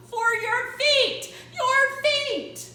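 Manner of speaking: loud, high and slow; insistent shout. Acted. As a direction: yell it at him, laughing. Dolores insisting loudly.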